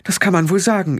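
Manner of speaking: sharp retort, pitched high; high-voiced, cutting matriarch. A narrator's reading. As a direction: answer tartly in a high voice. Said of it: "erwiderte die Kelder scharf".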